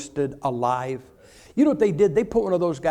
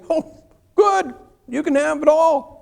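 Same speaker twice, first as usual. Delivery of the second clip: higher, louder, hammed-up no thanks; mock horrified refusal; 11 semitones higher, 6 dB louder, slower than usual. Preacher mockingly turning down martyrdom, hammed-up. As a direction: throw the offer back in mock horror.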